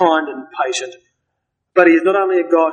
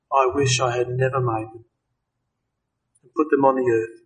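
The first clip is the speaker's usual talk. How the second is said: softer, sorrowful groan of regret; groaning lament; 5 semitones lower, 6 dB quieter than usual. Acted. Preacher voicing God's grieved regret over mankind, soft groan. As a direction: let God's regret sink out, softly.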